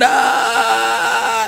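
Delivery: high hammed-up wail of a punished child; mock child's cry. Preacher imitating a caned child, mock crying yelp. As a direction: yelp like a kid being caned.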